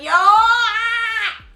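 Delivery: sudden high shouted outburst; retold yell. Telling the story of yelling at her ex, a sudden high shout. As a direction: burst out yelling at him. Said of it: "I started yelling".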